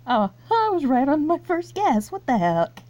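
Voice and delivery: amused shock at being right; laughing surprise. Real, not acted. Quiz player finding the first guess was right, laughing surprise.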